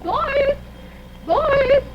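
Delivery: high calling voice. Pretend. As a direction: call the boys from downstairs. Mother calling the boys.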